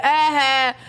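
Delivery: giggly, teasing ah-ha; laughing ah-ha. Retold or imitated. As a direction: laugh it out smugly. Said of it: "I'm like".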